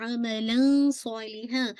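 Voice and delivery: अमलन सालिहा slow with hidden nasal; slow ikhfa on अमलन; recitation demo. Shown as a demonstration. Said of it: "इख्फा होगा". (अमलन सालिहा इख्फा के साथ)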